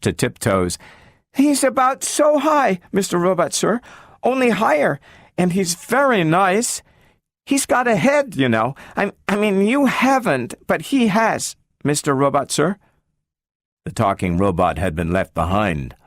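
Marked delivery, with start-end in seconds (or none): 1.3-12.8 put-on much higher, hopeful child voice; a small girl asking after her lost friend; eager little girl. A narrator's reading. Gloria asks the talking robot about Robbie, high and hopeful.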